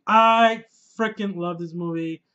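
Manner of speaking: loud, slow, emphatic declaration; emphatic love. Real, not acted. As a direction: lean hard on every word. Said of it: "I love this movie".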